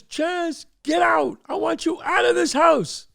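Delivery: high, yelled order; angry father. Retold or imitated. Father orders son's friend out of the house, angry yelling.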